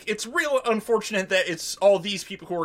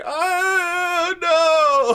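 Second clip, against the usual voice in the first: high, loud drawn-out mock wail; drunk wail; 11 semitones higher, 6 dB louder than usual. Imitating drunk [name] as everybody starts yelling, high loud wail. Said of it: "clearly had a lot of shots".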